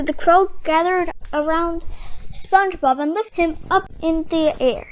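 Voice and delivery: ordinary delivery, nothing marked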